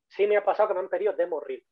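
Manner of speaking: usual speaking voice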